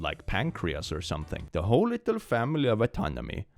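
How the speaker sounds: usual speaking voice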